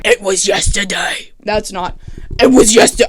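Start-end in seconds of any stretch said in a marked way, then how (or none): 0.0-1.3 failed attempt at a low villain voice; Slade's villain voice. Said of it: "I can't do the voice". 2.4-3.1 loud, botched villain impression; Slade's villain voice. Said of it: "I can't do the voice".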